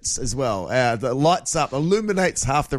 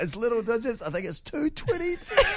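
soft, higher mock-struggling voice breaking into laughter; half-asleep squinting; 7 semitones higher, 6 dB quieter than usual. Half asleep reading a tiny clock, hammed-up soft bleary squint. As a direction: act out peering blearily at tiny numbers, softly. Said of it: "you're half asleep and your eyes are trying to focus".

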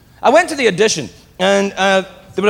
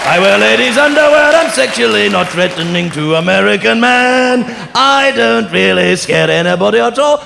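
hammed-up English accent, pitched up and loud; terrible English accent; 4 semitones higher, 4 dB louder than usual. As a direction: ham up a dreadful English accent. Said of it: "that is the worst English accent".

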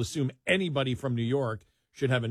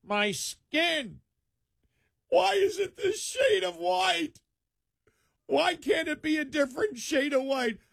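hammed-up, much higher whiny wail, slow; mock self-hatred; 11 semitones higher than usual. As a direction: parody a self-hating rant, high and drawn out. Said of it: "I don't sit there and go".

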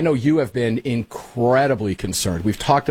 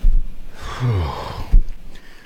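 exasperated sigh; 6 semitones lower than usual. Real, not acted. Host reacts to played clip.

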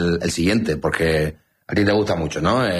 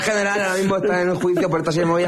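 high-pitched rapid-fire info-dump hero; superhéroe informativo; 9 semitones higher than usual. Pretend. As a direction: play a superhero who spouts facts, pitched way up. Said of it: "Información, man". (superhéroe informativo con voz muy aguda)

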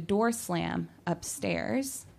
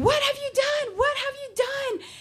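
high-pitched retold scream, far above normal voice. Wife shrieking at husband over her shirt in the wash, screaming. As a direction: shriek it in panicked disbelief. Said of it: "I'm screaming".

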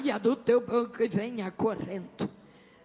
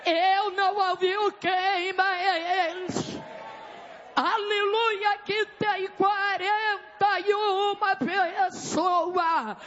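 fervent revival preaching; 5 semitones higher than usual. Real, not acted. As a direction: preach with forceful, stretched-out words to rouse the church. Preaches at full force to church. (pregação pentecostal fervorosa)

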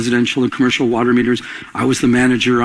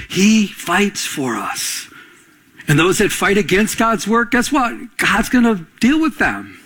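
higher-pitched, insistent sermon delivery; impassioned preaching; 5 semitones higher than usual. Real, not acted. Pastor assuring church God fights their battles, impassioned preaching. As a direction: preach it with mounting conviction.

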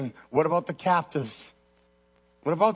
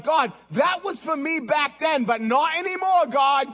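high-pitched, emphatic refusal, acted; protesting to God; 9 semitones higher, 4 dB louder than usual. Weary believer telling God those days are over, protesting. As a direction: push back at God, voice raised high.

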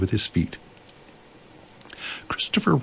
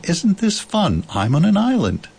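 put-on excited boy; 10 semitones higher than usual. A narrator's reading. Christopher Robin tells Owl about his island, excited. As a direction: share the thrill in a high voice.

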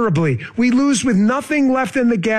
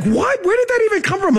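much higher, mock-incredulous outburst; baffled reaction; 11 semitones higher than usual. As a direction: act out a listener's high-pitched disbelief. Acts out a baffled listener's reaction.

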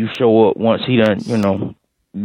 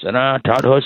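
normal talking voice

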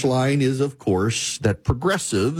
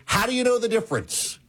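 mock-sceptical professor, much higher; professor challenging; 10 semitones higher than usual. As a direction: ask it like a doubting professor, lightly hammed. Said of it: "And then he says".

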